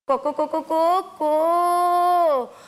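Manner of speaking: repeated short notes into one long held crow; rooster crow. Shown as a demonstration. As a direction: crow as a riddle for the children to guess. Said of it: "പൂവൻ കോഴിയാണല്ലേ".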